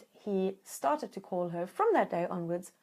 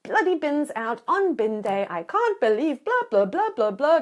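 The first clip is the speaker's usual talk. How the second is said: angry blaming rant; 2 semitones higher, 5 dB louder than usual. Shown as a demonstration. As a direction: rant at the partner about the bins. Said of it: "coming at it from your strength and go".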